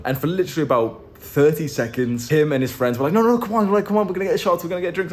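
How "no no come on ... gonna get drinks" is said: high, insistent mock pleading; raised, urgent coaxing; egging on. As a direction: imitate rowdy friends pushing for drinks. Friends retold pressing him to drink.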